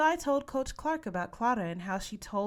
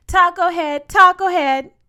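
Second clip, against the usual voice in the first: full-throated high mocking yell; yelled taunt; 11 semitones higher, 16 dB louder than usual. A narrator's reading. Bully yelling a taunt at Sophia, very loud. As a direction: scream the name to humiliate her. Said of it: "she yelled".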